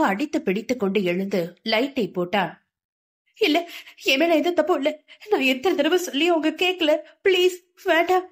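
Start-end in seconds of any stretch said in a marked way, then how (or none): 3.4-8.3 higher frantic rambling plea; delirious crying out. A narrator's reading. Sindhu raving in her nightmare, high frantic pleading. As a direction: babble it out, rising into please. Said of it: "பிதற்றிக் கொண்டு கத்தினாள்".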